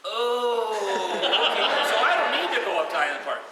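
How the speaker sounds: loud, laughing through the words. Retold or imitated. Mark realizing the prank, laughing loudly. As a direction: laugh it out, relieved.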